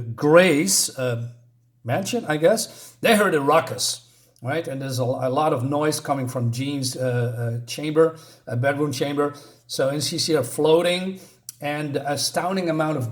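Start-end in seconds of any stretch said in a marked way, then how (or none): none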